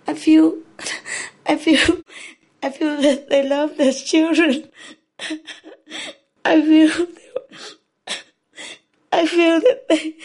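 overcome with emotion. Real, not acted. Speaker pleads that people will save the children, overcome with emotion. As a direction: choke up and push on through real tears. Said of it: "I'm quite emotional".

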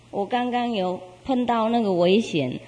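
ordinary delivery, nothing marked

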